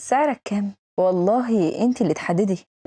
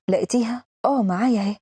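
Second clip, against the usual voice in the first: usual speaking voice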